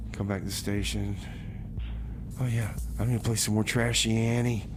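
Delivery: ordinary delivery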